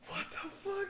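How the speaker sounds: high startled cry of disbelief; shocked yelp. Retold or imitated. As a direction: yelp it out, caught completely off guard. Friend shocked as horses appear.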